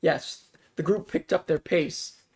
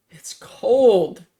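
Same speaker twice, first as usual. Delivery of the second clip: loud, higher complaint about the cold; exclaiming; 5 semitones higher, 8 dB louder than usual. A narrator's reading. Austin complaining to the group about the cold, loud exclaim. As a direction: blurt it out, loud. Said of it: "exclaimed Austin".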